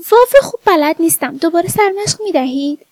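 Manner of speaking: lightly acted coy girl; coy pleading. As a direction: ask the teacher sweetly, pitch lifted. Pupil coyly asks teacher for another example.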